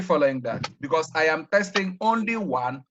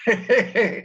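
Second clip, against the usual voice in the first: higher, amused chuckle; amused laugh; 6 semitones higher, 3 dB louder than usual. Real, not acted. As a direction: laugh at the student's change of mind. Amused at student changing her mind.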